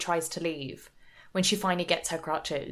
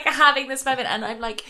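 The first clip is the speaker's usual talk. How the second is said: ordinary talk, nothing marked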